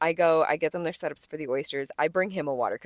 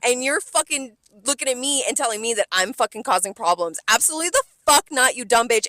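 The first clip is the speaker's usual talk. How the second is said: loud, higher furious venting; angry rant; 4 semitones higher, 4 dB louder than usual. Retold or imitated. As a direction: tear into her, loud and high. Ranting at the rude customer.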